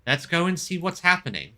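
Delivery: ordinary talk, nothing marked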